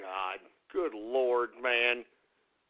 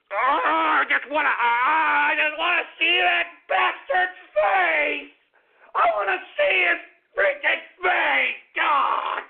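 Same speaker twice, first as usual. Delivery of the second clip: higher, louder and slow, venting pure rage; furious shout; 5 semitones higher, 3 dB louder, slower than usual. Real, not acted. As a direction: shout it out, desperate to see his face. Host raging at the bureaucrat.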